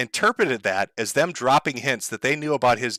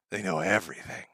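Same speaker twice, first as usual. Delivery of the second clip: low, much softer repeat; hushed paranoid echo; 6 semitones lower, 8 dB quieter than usual. Retold or imitated. Jack muttering to himself that they know, hushed. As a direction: drop it to a low murmur of dread. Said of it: "he's like".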